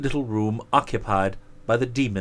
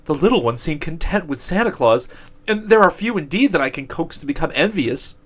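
higher whiny demon; envious demon voice; 6 semitones higher, 4 dB louder than usual. Acted. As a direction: grumble as a jealous demon. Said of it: "rejoined the demon of envy".

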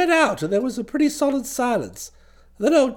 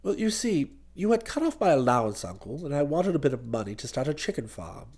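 soft, lower, hesitant explanation; knocked-out nephew; 10 semitones lower, 6 dB quieter than usual. A narrator's reading. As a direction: explain meekly to the uncle, voice sinking. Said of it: "Bicky seemed pretty well knocked out".

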